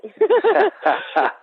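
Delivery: high, loud burst of laughter. Real, not acted. Guest to interviewer about the bad movement, laughing. Laughs while querying the question.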